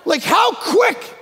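astonished shout; incredulous outcry. Real, not acted. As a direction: exclaim in mock outrage. Incredulous preacher.

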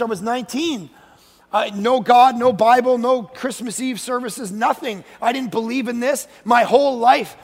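ordinary talk

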